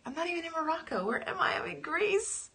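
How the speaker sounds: high-pitched, puzzled self-quote; mock bewilderment. Retelling her puzzled reaction in meditation.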